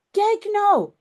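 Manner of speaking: put-on excited child calling out. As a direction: call the others to come and look. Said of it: "roept Janneke".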